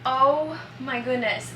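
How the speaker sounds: ordinary delivery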